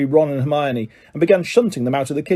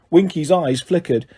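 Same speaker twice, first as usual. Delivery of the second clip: usual speaking voice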